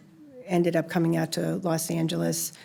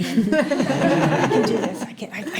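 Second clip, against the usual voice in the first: laughing; 6 semitones higher, 3 dB louder, slower than usual. Retold or imitated. Pep talk with laugh.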